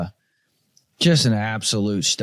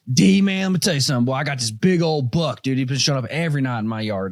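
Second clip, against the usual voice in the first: father-in-law bragging. Retold or imitated. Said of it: "He's like".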